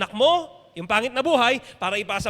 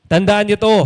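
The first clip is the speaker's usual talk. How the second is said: emphatic call; 10 dB louder than usual. Real, not acted. Preacher calls for attention.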